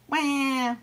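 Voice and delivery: one high, loud imitated wail; baby seal cry. A narrator's reading. Baby seal following Ebb, high wail. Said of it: "went the baby seal".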